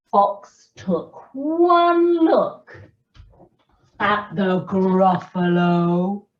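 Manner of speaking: slow, animated storyteller; story reading to children. A narrator's reading. Storyteller reading the Gruffalo to children, animated and unhurried. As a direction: read it slowly with big pitch swoops and pauses.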